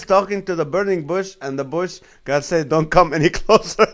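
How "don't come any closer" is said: laughing. Real, not acted. Reading a funny comment aloud.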